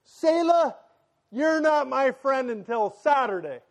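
put-on a small boy sulking at his sister; son's voice. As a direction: play the sulking little son, pitched well up. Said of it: "And I hear Case go".

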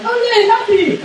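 squeaky high mock cheer; hammed-up glee, way up high; mock happy. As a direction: toss it off like a giddy kid. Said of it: "he's not just gonna go like".

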